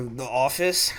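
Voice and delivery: ordinary talk, nothing marked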